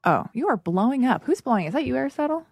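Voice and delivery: ordinary talk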